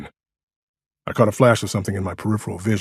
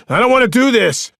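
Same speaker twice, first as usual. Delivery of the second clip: desperate high, loud shout; yelling; 10 dB louder than usual. A narrator's reading. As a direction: yell to stop someone. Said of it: "Diggs yelled".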